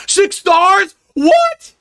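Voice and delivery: can't believe the pull, rising to a yelp; stunned gacha-pull surprise; real shock. Real, not acted. Gacha pull gives a rare character.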